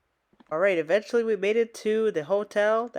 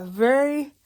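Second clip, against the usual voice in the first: tired laugh; 6 semitones higher, 5 dB louder, much slower than usual. Real, not acted. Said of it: "very tired".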